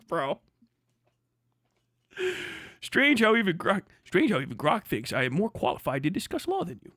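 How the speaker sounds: ordinary talk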